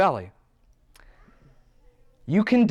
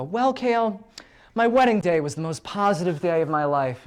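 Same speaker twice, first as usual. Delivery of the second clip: a lightly voiced eager client; hint of an eager client; 4 semitones higher than usual. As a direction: mimic an earnest client. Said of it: "they'll go".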